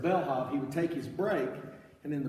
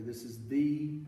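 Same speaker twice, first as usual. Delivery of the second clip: usual speaking voice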